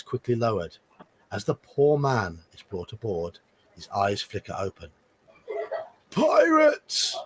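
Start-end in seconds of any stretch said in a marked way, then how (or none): 6.1-7.2 dying man's cry. Acted. Dying survivor gasps out word.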